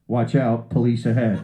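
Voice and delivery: loud, low navigation alert; Waze app voice. Acted. Navigation app warning the driver, announcer-like. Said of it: "this is what I hear from Waze".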